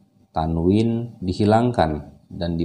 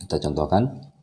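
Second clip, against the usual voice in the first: ordinary delivery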